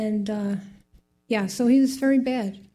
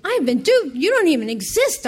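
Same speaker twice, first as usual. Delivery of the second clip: upset outburst; 6 semitones higher, 4 dB louder than usual. Retold or imitated. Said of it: "I was so upset".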